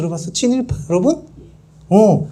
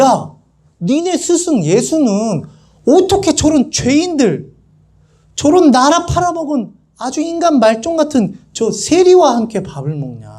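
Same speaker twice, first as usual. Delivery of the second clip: high, acted indignant reproach; accusing challenge; 7 semitones higher than usual. Pharisees confronting Jesus's disciples, accusingly. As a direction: confront them, pitch up, then drop at the end. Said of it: "제자들한테 따지는 거예요".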